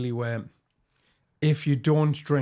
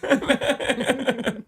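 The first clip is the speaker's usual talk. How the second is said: laughing; 10 semitones higher than usual. Real, not acted. Host laughs repeating name.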